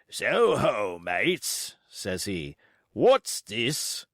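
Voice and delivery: rough cheerful seaman voice; old sea cook hailing mates; hearty sailor. Acted. Long John on deck.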